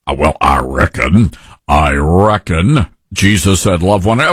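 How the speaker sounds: mock anchorman, dropped very low; fake news anchor voice. Host parroting the anchor's objection about loving one another, mock anchor voice. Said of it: "he's got the fake news anchor voice on".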